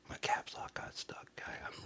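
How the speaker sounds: whispered confession; hushed apology to chat; sheepish whisper. Pretend. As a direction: whisper it like an embarrassed aside. Sheepish aside confessing mistake.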